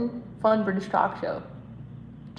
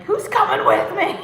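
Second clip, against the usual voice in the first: higher, louder with a laugh; [name] asking who will follow him; mock rallying call; 11 semitones higher, 7 dB louder than usual. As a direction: call out to the room half-laughing.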